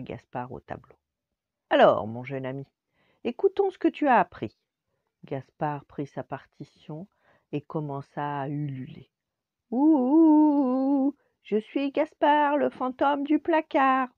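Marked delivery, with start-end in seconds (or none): 1.7-4.6 voix de professeur plus forte, plus aiguë à la fin; professeur sévère. Acted. Le professeur appelle Gaspard au tableau. 9.7-14.1 ululement aigu et fort de fantôme; petit fantôme qui ulule. Acted. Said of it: "on dirait un petit hibou".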